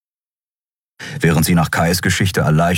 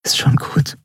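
whisper; 10 semitones higher than usual. A narrator's reading. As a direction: hush him gently, all breath. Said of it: "flüsterte sie".

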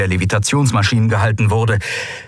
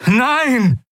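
high, desperate shout of refusal; crying out. Acted. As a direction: cry out in protest, pitch leaping up. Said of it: "rief Kai aus". (verzweifelter, hoher Aufschrei)

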